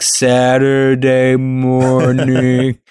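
very slow drawn-out words. Retold or imitated. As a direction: stretch it out like slowed playback. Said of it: "he'll be like".

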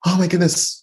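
surprised teacher. Retold or imitated. Teacher panics, no makeup artist.

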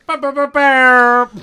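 loud, high, drawn-out horn noise; imitated sound effect. Shown as a demonstration. Co-host filling in for the soundboard, blaring imitation.

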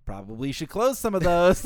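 chirpy nagging website voice; voiced website. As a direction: make the website nag like a person.

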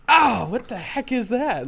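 high, drawn-out mock outcry; startled outcry. Students hit by a high-pitched squeal, startled outcry. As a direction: imitate pupils recoiling from a noise.

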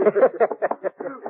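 a high, knowing snicker; leering laugh. Acted. Deputy laughing at his crude joke about Jenny. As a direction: laugh with a sly leer.

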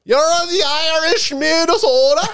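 put-on high, slow, laughing Irish-Minnesota accent; mock Irish-Minnesota accent. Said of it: "sounds like he's from Ireland".

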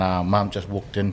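normal talking voice

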